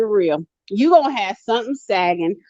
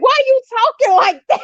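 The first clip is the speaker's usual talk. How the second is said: high-pitched mock disbelief; incredulous question; 12 semitones higher, 4 dB louder than usual. Questioning the man's changed voice on the phone, incredulous. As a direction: challenge him, pitched way up in bafflement. Retold puzzled challenge to the man.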